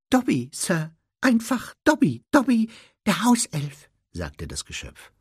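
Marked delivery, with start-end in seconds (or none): 0.1-3.9 high squeaky house-elf; Dobby. A narrator's reading. House-elf introduces himself to Harry, squeaky and high.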